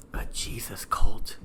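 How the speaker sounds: slow, confiding whisper; hushed, drawn-out reveal. Real, not acted. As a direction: whisper it like a forbidden verdict. Hushed verdict after a pause.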